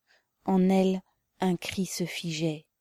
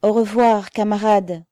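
loud, raised goodbye; farewell shout; 4 semitones higher, 8 dB louder than usual. A narrator's reading. Prisoners call farewell to the crowd, loud shout.

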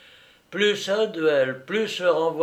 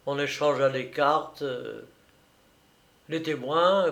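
usual speaking voice

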